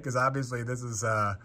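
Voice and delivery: ordinary talk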